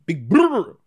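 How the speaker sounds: brr with a long rolled trill r. Shown as a demonstration. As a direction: roll the r hard in brr. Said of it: "I'm rolling my R's a little bit better".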